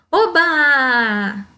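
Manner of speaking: a bright little cry of oba; joyful exclamation. Shown as a demonstration. Teacher acts out a happy exclamation for pupils. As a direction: exclaim oba like good news.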